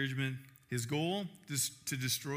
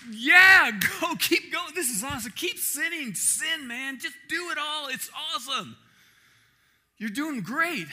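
loud, high, egging-on devil voice; hammed-up devil hyping up sin; tempting Satan; 11 semitones higher, 10 dB louder than usual. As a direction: cheer the sinner on like a gleeful tempter. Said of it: "he'd be".

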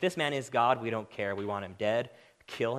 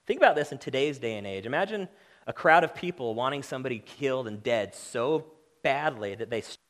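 loud, driving sermon voice; preaching at full force; 6 dB louder than usual. Acted. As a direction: hammer the point home to the congregation. Pastor pressing the point hard.

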